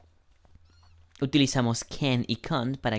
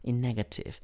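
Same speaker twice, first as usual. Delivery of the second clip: normal talking voice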